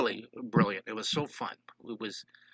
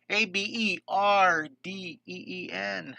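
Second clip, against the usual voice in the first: each letter slow and a bit high; spelled out slowly; 5 semitones higher, slower than usual. Shown as a demonstration. Art teacher spelling the viewer's town for chat, slow letters. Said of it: "spelling the town slowly".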